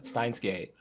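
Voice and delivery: usual speaking voice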